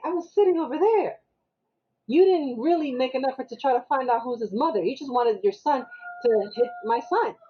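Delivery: retold argument, high and insistent; heated mother-to-mother telling-off; indignant confrontation. Acted quarrel between two mothers.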